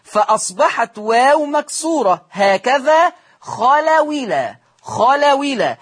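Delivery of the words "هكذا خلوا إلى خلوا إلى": خلوا إلى said as خلوِلى, the hamza dropped; slow, with a kasra on the waw of خلوا; naql without hamza. Shown as a demonstration. Teacher demonstrates dropping the hamza for students. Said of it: "مع حذف الهمزة".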